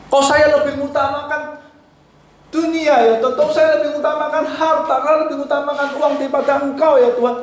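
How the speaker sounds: high, pleading confession to God; lamenting prayer. Shown as a demonstration. Preacher voicing a repentant prayer, high and pleading. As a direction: plead like a sinner weeping before God. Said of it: "Nangis, ratapi".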